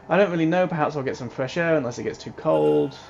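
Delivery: usual speaking voice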